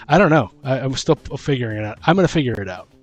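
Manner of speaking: ordinary talk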